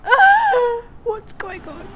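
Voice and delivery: high, teary, mock-weepy wail; mock crying. Tearful vlogger mocking her own weepiness. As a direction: pretend to burst into tears over nothing. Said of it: "I'm like".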